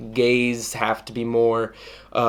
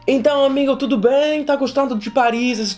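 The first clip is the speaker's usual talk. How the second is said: chirpy flirty Portuguese; 9 semitones higher than usual. Retold or imitated. Trying Portuguese on stranger.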